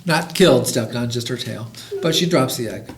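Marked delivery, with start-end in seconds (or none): none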